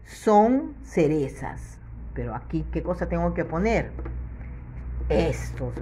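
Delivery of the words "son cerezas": slow, clearly spaced reading. Shown as a demonstration. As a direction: read the phrase out slowly. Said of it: "Acá dice".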